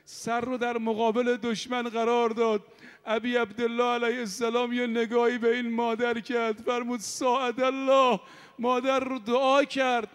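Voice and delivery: mourning sermon. Acted. Preacher recounting Karbala to mourners in a rising lament. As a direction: preach the martyr's story with rising grief.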